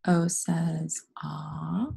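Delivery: slow "O says ah" with a long "ah"; name O, then stretch its sound "ah"; letter name, then sound. Shown as a demonstration. Teacher spelling away with pupils, "O says ah" very slowly. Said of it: "Spell the word away with me".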